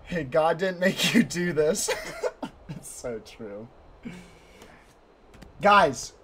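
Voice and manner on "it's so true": amused, dropping softer into agreement; amused laughing. Real, not acted. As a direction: laugh breaking in, then trailing softer. Laughs at a remark.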